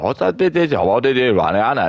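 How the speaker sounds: high, loud excited questioning; breathless starstruck fan; mock excited fan. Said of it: "then they'll be like".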